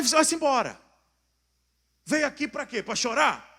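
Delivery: high, loud exasperated send-off; retold impatient dismissal. Pastor fed up with a silent crying man, retold impatient dismissal. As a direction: shoo him away in exasperation.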